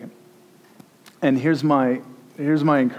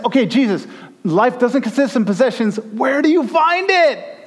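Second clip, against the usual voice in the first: a higher, pleading seeker's voice; eager questioner; 11 semitones higher, 5 dB louder than usual. Pretend. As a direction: ask it like a hungry disciple. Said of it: "No one asks".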